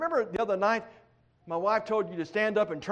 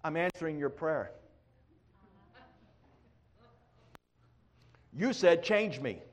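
softer, lower, calm divine answer; voice of God; 5 semitones lower, 6 dB quieter than usual. Acted. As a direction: answer gently, low and unhurried. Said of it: "here's what he says".

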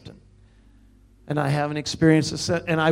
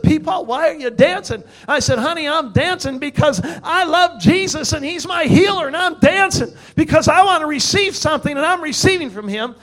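high, loud revival testimony; impassioned preaching; 8 semitones higher, 5 dB louder than usual. Real, not acted. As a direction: preach it with mounting excitement. Preaches testimony at rising force.

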